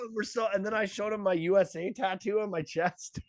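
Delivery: usual speaking voice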